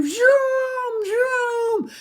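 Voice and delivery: very high, loud zooming sound; zooming kid noise. A narrator's reading. Little brother in cape flying through the room, high zooming. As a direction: zoom like a little kid playing plane.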